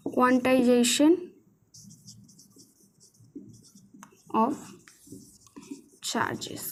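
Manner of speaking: each word stretched with long gaps; painfully slow dictation, fading at the end. Shown as a demonstration. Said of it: "English वाले लिख लो भाई".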